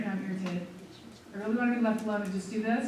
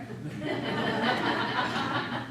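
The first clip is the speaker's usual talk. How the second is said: amused self-laughing chuckle; real laugh. Real, not acted. Laughing at herself while telling the seminar group. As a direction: laugh at yourself freely. Laughing at her own story.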